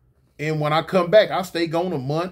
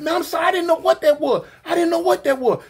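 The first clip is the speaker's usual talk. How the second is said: high-pitched, whiny frantic apology; mimicked panicky woman; startled woman apologizing; 11 semitones higher, faster than usual. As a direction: plead innocence in a high voice.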